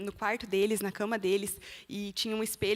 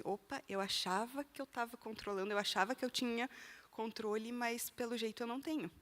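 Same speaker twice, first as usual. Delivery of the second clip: much softer, as if thinking aloud; quiet inner realisation; 11 dB quieter than usual. Retold or imitated. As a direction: voice an inner thought under the breath. Pensamento que teve consigo mesma. (pensamento em voz baixa, para si mesma)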